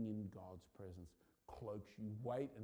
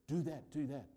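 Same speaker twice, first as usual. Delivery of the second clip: higher and louder, voiced as the pastor; eager plea; 5 semitones higher, 6 dB louder than usual. Pastor eagerly answering God in the vision, excited plea. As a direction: beg it out with urgency.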